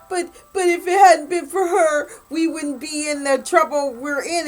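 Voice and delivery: high, loud, crying plea of a beaten father; pleading dad. Retold or imitated. Beaten father begs his daughter's captor, high and tearful. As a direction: wail it out like a desperate old man.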